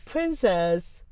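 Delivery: ordinary talk, nothing marked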